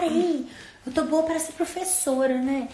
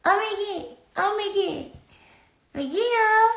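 higher, sing-song mock call of "amiguinho"; imitating friend's call; 8 semitones higher than usual. Retelling how Michael called her all the time, higher mock cooing. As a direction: mimic him cooing at you, pitched up. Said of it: "falando assim com o Michael".

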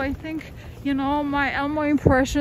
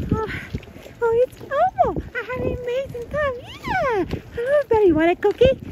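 high puppet voice, much higher throughout; Elmo voice; 11 semitones higher, faster than usual. Pretend. Fulfilling viewer requests with a much higher puppet voice. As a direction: bounce it up high like a kid puppet. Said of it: "let me try the Elmo".

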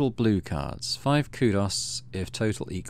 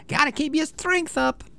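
put-on a chirpy kid bragging about lunch; cheerful Enemy. Enemy encourages you.

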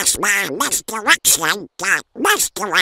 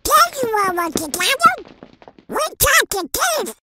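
duck voice; 14 semitones higher than usual. Acted. Nephews report catching thief.

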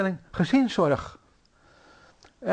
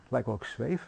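lower, softer, wondering rise at the end; puzzled echo; 6 semitones lower, 6 dB quieter than usual. Retold or imitated. The preacher, puzzled by a sister's odd remark, echoes it softly. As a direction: repeat it back as if unsure what it means. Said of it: "Ik weet niet zo gauw wat ze bedoelde".

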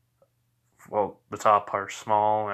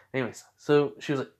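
ordinary talk, nothing marked

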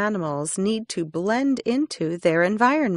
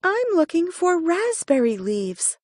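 little hare voice; 9 semitones higher than usual. A narrator's reading.

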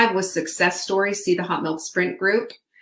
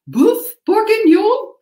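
put-on high, slow, warbling Julia Child voice, much louder; Julia Child imitation; 14 semitones higher, 8 dB louder, slower than usual. Host showing viewers her Julia Child impression, hammed up and high. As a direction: belt the French dish name like a TV chef. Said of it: "That's my Julia Child imitation".

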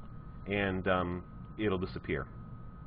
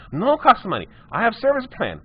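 much higher broken-English customer, insisting; high insistent customer; 10 semitones higher, 4 dB louder than usual. Retold or imitated. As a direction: squeak out the demands, won't budge. Customer voiced in retold story.

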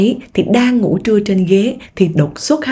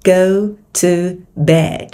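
GO, TO, BED slow and separated; careful word by word; much slower than usual. Shown as a demonstration. Teacher gives the full form first, careful GO TO BED. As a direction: give GO TO BED in full, pausing before BED. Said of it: "Nói nhanh".

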